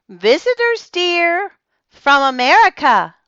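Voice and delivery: high, loud call through a door. A narrator's reading. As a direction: announce the guests sweetly but loudly.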